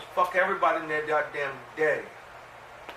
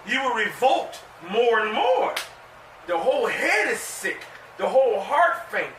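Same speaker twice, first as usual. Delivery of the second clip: preaching; 5 semitones higher than usual. Acted. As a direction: preach it like the Lord is scolding. Preaching scripture and the Lord.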